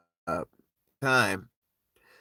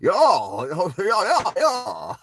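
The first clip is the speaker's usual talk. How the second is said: higher, louder laughing yos; excited yo calls; 9 semitones higher, 6 dB louder, much slower than usual. Retold or imitated. Recalling Bernie Mac's scene, laughing yo calls. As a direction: holler yo in awe. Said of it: "Bernie Mac, he got a lot of".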